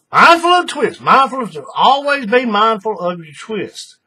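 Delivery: high, loud repeated warning; emphatic reminder. Real, not acted.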